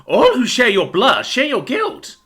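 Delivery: high, slow, crazed pronouncement; unhinged avenger, pitched high and drawn out; crazed villain. A narrator's reading. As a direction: pass sentence like a madman. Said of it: "smiled the crazed Billy Bumblebelly".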